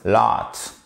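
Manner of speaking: "lot," with a short clipped o; short vowel. Shown as a demonstration. Teacher links the vowel to an earlier word. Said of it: "exactement le même".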